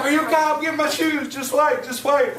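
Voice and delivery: imitating his wife checking on him, high and loud; worried wife. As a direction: squeak it out like the panicked wife. Voicing wife getting her shoes.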